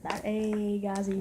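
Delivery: normal talking voice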